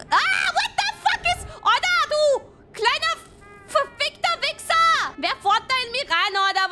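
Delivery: mock rage. Other car rams him in game.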